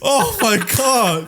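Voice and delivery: slow drawn-out high oh my God; stunned, stretched out; shocked. Real, not acted. Reacts to ass cheeks answer.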